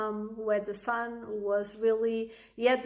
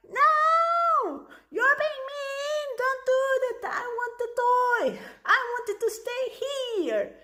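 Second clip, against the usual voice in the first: put-on upset child voice; 3 dB louder than usual. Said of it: "And the child would be like".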